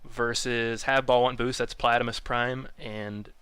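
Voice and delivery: slow, drawn-out lineup announcing; announcer read. Acted. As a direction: present each team name slowly.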